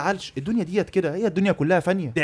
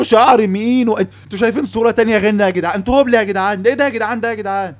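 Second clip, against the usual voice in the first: louder, incredulous outcry; loud disbelief; 4 semitones higher, 6 dB louder than usual. Real, not acted. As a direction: shout your disbelief at the crowd. مستنكر صورة البنت للجمهور. (صياح مستنكر عالي)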